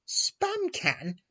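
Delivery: shrill high outraged echo; fuming. A narrator's reading. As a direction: spit the insult back in outrage. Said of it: "fumed one nine nine".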